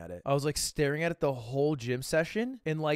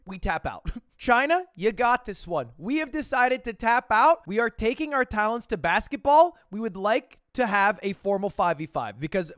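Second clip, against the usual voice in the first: calm turning angry; 6 semitones higher, 5 dB louder than usual. Pretend. Mock delegation speech gets heated.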